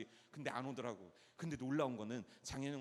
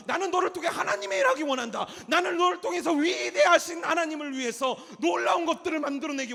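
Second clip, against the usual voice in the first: high, loud, urgent sermon shout; full-force preaching; 11 semitones higher, 14 dB louder than usual. Real, not acted. Preacher telling young people God will work through them, shouting. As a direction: drive every phrase at the congregation at full volume.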